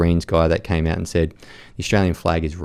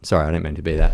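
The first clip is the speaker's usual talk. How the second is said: ordinary talk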